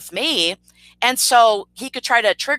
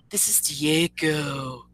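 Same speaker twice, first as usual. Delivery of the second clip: very slow, low, softer mock voice; Diego; 6 semitones lower, 7 dB quieter, much slower than usual. Streamer repeats the rival's self-introduction, low slow impression. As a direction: draw it out deep and slow in imitation. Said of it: "let me get his voice down".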